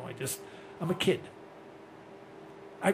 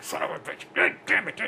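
hushed, breathy curses under the breath; mock self-directed cursing; muttered swearing. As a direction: swear to yourself, mad at your own mistake. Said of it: "I walk away swearing".

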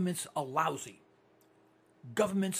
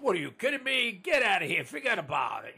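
brash New York "What are you kidding me"; New York accent; 3 dB louder than usual. Retold or imitated. Host imitating New Yorkers on air, light New York accent. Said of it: "As they say in New York".

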